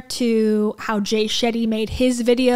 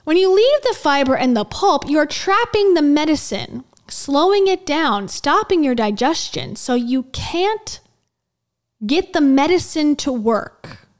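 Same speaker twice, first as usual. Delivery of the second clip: over-enunciated reading; 3 semitones higher than usual. Acted. Reading out a health guru's advice, over-enunciated. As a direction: read it out with every key word punched. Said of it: "He speaks like this and tries to enunciate".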